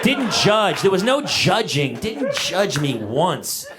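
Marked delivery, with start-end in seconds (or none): none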